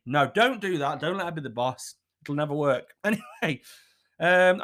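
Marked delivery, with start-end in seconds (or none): none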